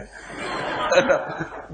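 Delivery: airy unvoiced chuckle at the stuck student; breathy laugh. Real, not acted.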